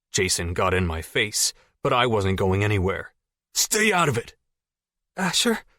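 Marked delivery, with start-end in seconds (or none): 3.5-4.3 angry. Acted. Jason yelling. 5.2-5.6 high, timid call; calling girl. Acted. Jess calling.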